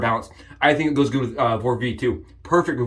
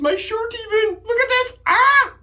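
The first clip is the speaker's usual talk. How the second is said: much higher, slow and giddy; delighted squeal. Real, not acted. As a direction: gush like the match is a tiny miracle. Delighted brush matches his shirt.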